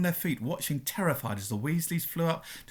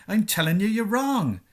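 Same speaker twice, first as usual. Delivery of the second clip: loud, raised-pitch heated insistence; hot-tempered; 6 semitones higher, 5 dB louder than usual. A narrator's reading. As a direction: insist hotly, rising higher and louder. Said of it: "said Hagrid hotly".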